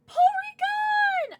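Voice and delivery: high, slow, loud squeal; excited shout. Real, not acted. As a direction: shriek the name with joy. Pulls a wanted card.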